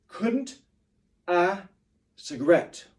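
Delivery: Couldn't high and rising, cigarette loud; stressed words on the beat; beat reading. Shown as a demonstration. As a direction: punch each stressed word. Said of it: "stressed words and reading them on the beat".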